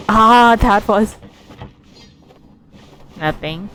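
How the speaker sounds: ordinary talk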